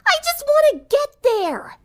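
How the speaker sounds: very high, slow, fed-up cry; girl at the end of her patience; exasperated outburst. A narrator's reading. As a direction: throw up your hands and wail it.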